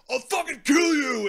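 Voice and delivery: high, loud gangster threat; mock threat. Reviewer mocks a rival gangster's threat with a loud high yell. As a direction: yell it like a cartoon thug. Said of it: "the other guy's like".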